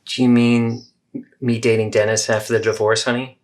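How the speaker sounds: the kind mother in the second take; supportive mother. Acted. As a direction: gently reassure your child. Supportive mom version.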